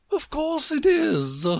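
very high, slow queenly reply; imperious Queen. A narrator's reading. Queen answering Alice's surprise, high imperious voice. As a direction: state it high and drawn out.

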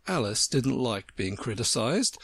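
plain reading voice

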